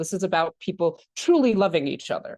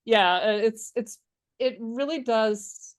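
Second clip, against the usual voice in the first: ordinary talk, nothing marked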